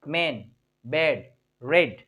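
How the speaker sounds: "मैन, बेड, रेड" slow and low, with a simple ए; low, unforced ए in "मैन, बेड, रेड"; simple ए vowel. Shown as a demonstration. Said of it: "simple बोले".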